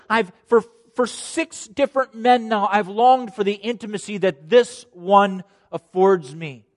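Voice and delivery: high, loud, impassioned outpouring; awestruck testimony. Acted. Woman at the well marvels at being wanted, impassioned and loud. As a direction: testify with full-voiced amazement at being wanted. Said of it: "And she says".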